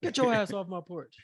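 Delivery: high mock threatening dad; angry dad. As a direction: run him off the porch. Dad chasing boyfriend off.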